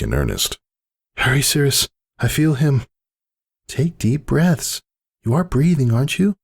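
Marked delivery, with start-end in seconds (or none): 1.2-2.8 urgent plea. A narrator's reading. 3.7-6.4 calm coaching. A narrator's reading. Cirrus coaches laboring mare.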